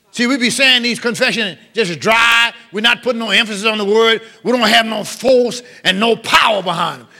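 ordinary delivery

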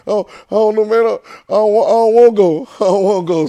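high, loud mock-panicked whine; nervous kid. Boy balking at praying before his friends, nervous. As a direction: play up a scared child's reluctance, half laughing. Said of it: "I'm nervous now".

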